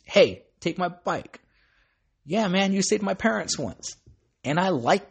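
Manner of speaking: a hint of an excited fan; eager street kid voice. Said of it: "from someone on the streets".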